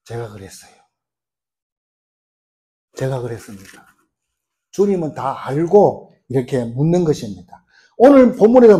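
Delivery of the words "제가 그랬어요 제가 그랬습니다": hushed, much softer confession; a ashamed woman owning up to Jesus, softly; timid confession. Acted. Woman confessing to Jesus, hushed and timid.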